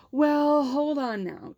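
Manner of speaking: high, drawn-out placating "well"; coaxing vice principal. Retold or imitated. Vice principal to student refusing the trip, coaxing. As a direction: imitate an official talking a student round.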